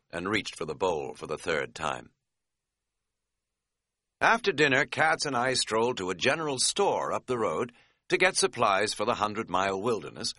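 ordinary delivery

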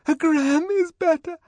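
high, loud shaken wail; tremulous protest. A narrator's reading. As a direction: squeal it while being shaken. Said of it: "in a voice made grotesquely tremulous".

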